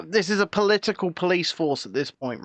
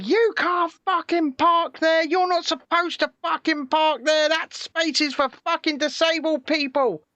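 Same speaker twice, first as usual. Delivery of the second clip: mock shrill rant, higher; imitating an angry woman's rant; retold screaming; 8 semitones higher than usual. Mimicking the woman yelling at him in the car park. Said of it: "she starts screaming obscenities at me".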